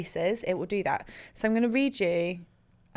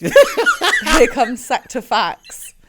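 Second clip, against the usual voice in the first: announcer voice; 4 semitones higher, 9 dB louder, much slower than usual. Acted. Announces the segment jingle-style.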